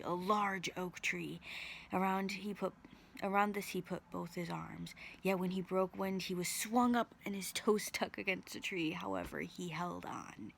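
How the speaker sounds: ordinary delivery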